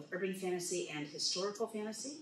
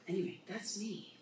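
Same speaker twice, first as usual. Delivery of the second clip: ordinary talk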